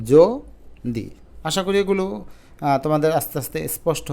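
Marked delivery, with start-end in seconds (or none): 0.1-1.0 জ and দি apart, "jo... di", long pause between; syllable by syllable. Shown as a demonstration. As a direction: sound out জ as rounded jo, then দি. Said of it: "উচ্চারণটা এরকম হয়ে যাবে".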